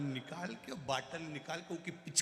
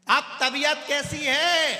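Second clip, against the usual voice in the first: shouted question; 11 semitones higher, 12 dB louder, slower than usual. Retold or imitated. Deaf man shouts question at patient.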